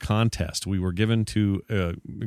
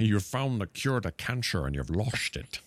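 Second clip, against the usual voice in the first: Sean Connery impression; 8 dB quieter than usual. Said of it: "It's my Sean Connery".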